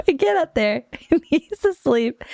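high-pitched, laughing through the words; real laughter. Real, not acted. Resuming the story after the fox joke, still laughing. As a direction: fight the giggles while telling it.

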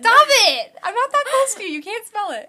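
high, loud shout at her sister. Real, not acted. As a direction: yell stop it, half laughing. Sister teasing about bacon breath.

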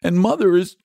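put-on high, aggrieved small boy; acted tearful child voice; tearful complaint. A narrator's reading. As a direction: pour out the grievance, close to breaking down. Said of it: "here he broke down again".

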